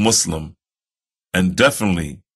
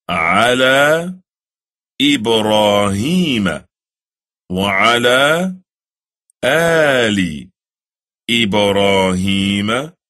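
slow, measured Arabic prayer recital; slow recitation; slower than usual. Shown as a demonstration. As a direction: recite each word slowly and reverently.

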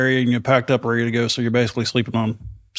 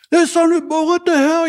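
high muffled mock panic; imitating Todd cussing. Mimicking Todd panicking and cursing inside his gas mask. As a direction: squeak out his swearing like a man in a gas mask.

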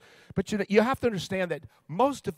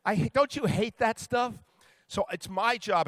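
normal talking voice

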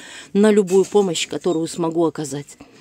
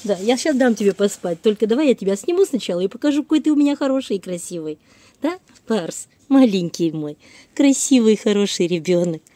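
squeaky praising a dog; 4 semitones higher, 5 dB louder than usual. Real, not acted. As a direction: gush over the dog in a squeaky voice. Cooing praise to her dog.

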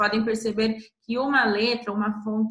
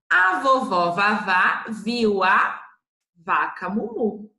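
slow, a bit louder, word by word; slow reading; 4 dB louder, slower than usual. Shown as a demonstration. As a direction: read it out clearly for the class. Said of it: "teacher reads sentence slowly".

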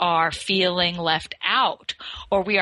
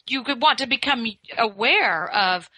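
ordinary talk

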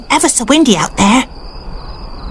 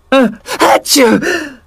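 sneeze. Acted. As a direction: gasp then big sneeze. Sarah catching a cold.

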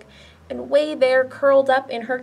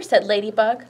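usual speaking voice

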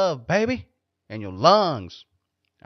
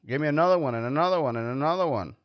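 greedy rush; 3 semitones higher, faster than usual. Pretend. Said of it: "greedy drinking bit".

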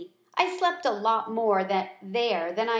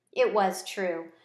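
ordinary delivery

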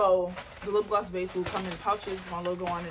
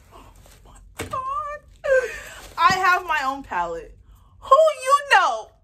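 joyful scream; 11 semitones higher, 9 dB louder than usual. Real, not acted. Unboxing her palette, screaming joy.

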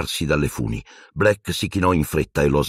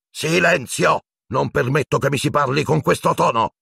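high, furious command; crazed screech; 9 semitones higher than usual. Acted. Teacher forbidding that tone, crazed screeching. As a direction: shriek for silence, half mad. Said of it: "strillò Piton, più folle che mai".

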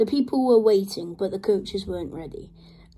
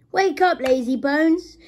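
high, loud teasing wake-up call; cheeky; 6 semitones higher, 7 dB louder than usual. A narrator's reading. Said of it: "in his cheeky way".

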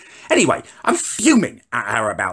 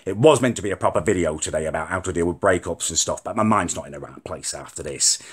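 ordinary talk, nothing marked

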